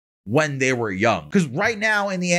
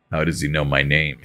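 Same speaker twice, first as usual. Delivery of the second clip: much lower, put-on hero voice; deep Hawks voice; 11 semitones lower than usual. Reading the hero's line aloud in a deep put-on voice. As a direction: drop the voice way down for the hero. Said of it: "why'd I just give Hawks that deep ass voice".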